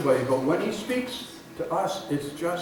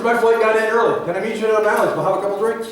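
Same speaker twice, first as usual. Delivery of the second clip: loud, higher cell-phone chatter; overheard phone caller; 6 semitones higher, 9 dB louder, faster than usual. Retold or imitated. Stranger in the next stall arranges drinks by phone, loud chatter. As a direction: lightly act out a stranger talking loudly on the phone. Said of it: "I'll hear the voice continue".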